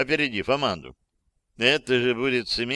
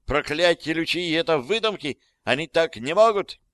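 high-pitched, panicky protest; high, agitated outburst; shrieked denial; 6 semitones higher, 4 dB louder than usual. A narrator's reading. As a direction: squeal it out in disbelief. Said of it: "взвизгнула она".

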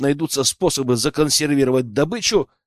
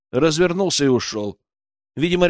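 usual speaking voice

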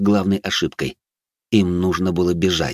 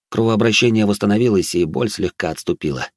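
ordinary delivery, nothing marked

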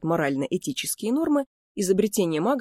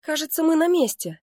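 high, eager exclamation; excited announcement; 11 semitones higher, 3 dB louder than usual. A narrator's reading. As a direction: call out the arrival with excitement. Twin spots the destination, calls out. (радостно-взволнованный возглас)

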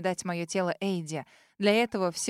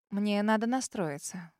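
usual speaking voice

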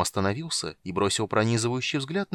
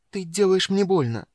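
higher, louder cry of pain; 10 semitones higher, 6 dB louder than usual. A narrator's reading. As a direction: cry out as your wrist is hurt. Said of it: "тихо вскрикнула Эмберил". (вскрик от боли)

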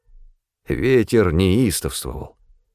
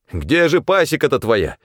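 raised pitch, open irritation; irritated shout; 6 semitones higher than usual. A narrator's reading. Supply man demanding where Pavel's apiary is, irritated. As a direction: call out with undisguised annoyance. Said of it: "не скрывая раздражения, крикнул снабженец".

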